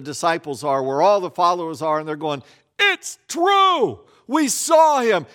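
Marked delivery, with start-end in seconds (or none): 2.8-5.2 high, loud and bursting with news; excited shout. Retold or imitated.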